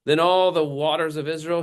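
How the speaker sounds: high, indignant rant of a proud general; a slighted commander ranting in outrage; ranting Naaman. Acted. Naaman ranting at being snubbed by the prophet, high and indignant. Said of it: "he continues his rant".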